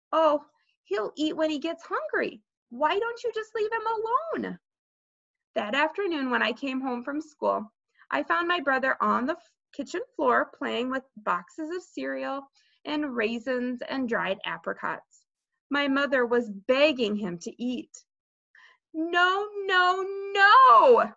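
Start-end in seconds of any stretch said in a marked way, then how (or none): 0.1-4.6 put-on high, whiny kid's complaint; exasperated protest. A narrator's reading. Peter telling mother to leave Fudge alone, exasperated. 18.9-21.1 put-on high, building toddler yell; shouted refusal. A narrator's reading. Fudge refusing his begging mother, high tantrum shout. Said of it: "Fudge shouted".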